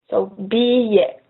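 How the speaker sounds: billet said slowly as bee-yeh, double L like a Y; drawn-out billet, the LL gliding like Y. Shown as a demonstration. Teacher showing museum ticket word, slow and careful. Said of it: "same sound as the Y".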